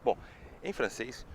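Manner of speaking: ordinary delivery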